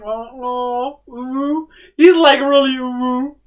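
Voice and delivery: high squealing coos; overwhelmed by cuteness. Real, not acted. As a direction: gush over the tiny paws. Squealing over cute cat paws.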